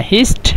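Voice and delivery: "Hist" with the final t stopped quickly; quick-stopped ending. Shown as a demonstration. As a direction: cut "Hist" off quickly at its end. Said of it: "هكذا الأشياء شوية يقف بسرعة".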